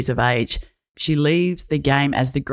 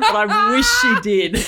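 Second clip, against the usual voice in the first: laughing; 7 semitones higher, slower than usual. Real, not acted. Laughing tease.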